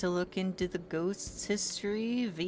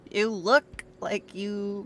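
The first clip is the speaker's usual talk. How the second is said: slow, higher teasing line; teasing friend; 4 semitones higher, 6 dB louder, slower than usual. Acted. Friend teasing the shaken investigator, slow and high. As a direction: draw it out like poking fun.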